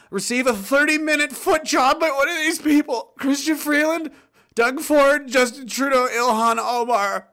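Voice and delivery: high-pitched, agonised forced read; reading under duress. Pretend. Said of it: "this is under duress".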